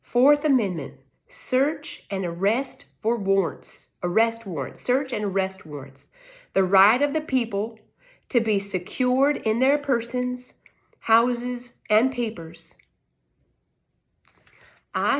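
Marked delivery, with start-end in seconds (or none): none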